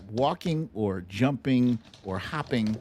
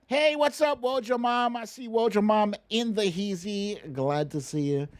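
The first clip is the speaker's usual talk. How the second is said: higher, hammed-up cheery hello; mock greeting; 10 semitones higher than usual. Host mimicking Pelosi greeting him, mock cheery and higher. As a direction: play it like a gushing fake-friendly host.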